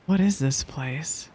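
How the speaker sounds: ordinary delivery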